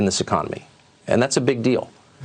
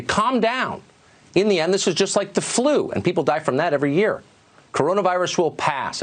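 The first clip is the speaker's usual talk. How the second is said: higher-pitched imitation of leaders downplaying it; mock dismissive; 7 semitones higher than usual. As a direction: brush off the worry as they would. Quoting leaders who minimize the virus.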